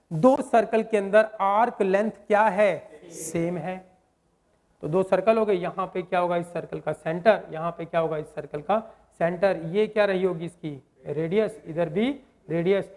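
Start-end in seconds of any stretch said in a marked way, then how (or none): none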